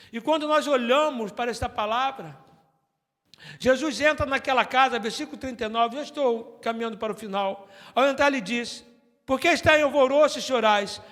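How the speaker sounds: loud, high preached text; full-force preaching. Acted. Pastor proclaiming Jesus's words to the church, loudly. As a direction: proclaim the verse boldly.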